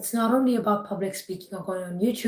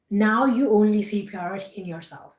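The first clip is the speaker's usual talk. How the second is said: ordinary talk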